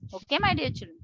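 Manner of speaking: usual speaking voice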